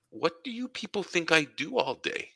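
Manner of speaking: retold exasperation, pitched well up; mock-indignant question to the family; exasperated retort.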